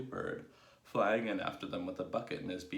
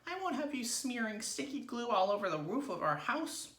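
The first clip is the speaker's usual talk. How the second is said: very high-pitched scolding wife; 3 dB louder than usual. A narrator's reading. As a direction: forbid it in a high character voice. Wife scolds husband in story.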